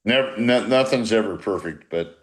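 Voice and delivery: normal talking voice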